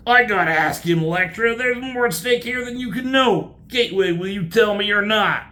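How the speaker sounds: high, loud, insistent character voice; urgent demanding hero. Acted. Hero demanding answers from Gateway, urgent and loud.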